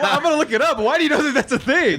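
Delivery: high, giggly, still laughing; can't stop laughing while asking. Real, not acted. Teasing cohost over the popes page, laughing.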